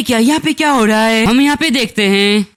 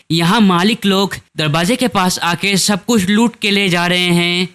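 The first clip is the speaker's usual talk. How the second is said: ordinary delivery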